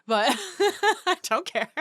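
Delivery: high giggling laugh. Real, not acted. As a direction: laugh through it, carefree. Laughing at own lie.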